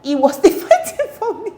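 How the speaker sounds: high, loud laugh through the words. Real, not acted. Telling viewers about boys' fight over her, laughing. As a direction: squeal it out, cracking up.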